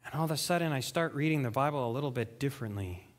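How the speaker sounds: ordinary delivery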